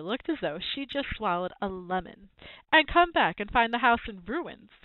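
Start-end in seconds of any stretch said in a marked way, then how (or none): 2.7-4.7 higher, louder indignant retort; snarling. A narrator's reading. Aunt rejects her nephew's suggestion, snarling. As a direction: throw it back with a mild snarl. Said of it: "she snarled".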